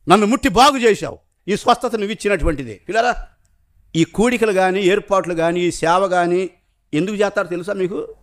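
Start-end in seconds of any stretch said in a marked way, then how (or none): none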